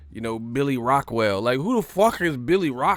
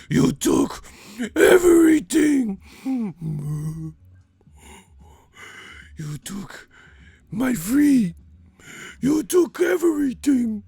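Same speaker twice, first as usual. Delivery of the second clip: high, loud falsetto wail, stretched out; mock falsetto wail; 11 semitones higher, 6 dB louder, slower than usual. Comedian mocking a fighter with a high falsetto wail. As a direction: wail it like a heartbroken singer. Wailing imagined heartbroken fighter.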